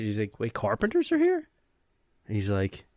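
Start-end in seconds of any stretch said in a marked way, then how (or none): 0.4-1.5 puzzled, pitch jumping up; mock surprised question; surprised apprentice. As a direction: imitate a green kid caught by surprise. Green apprentice reacts in surprise.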